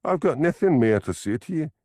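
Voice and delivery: high, firm Glaswegian refusal; defiant. A narrator's reading. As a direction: shut the conversation down. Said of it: "She stared at him defiantly".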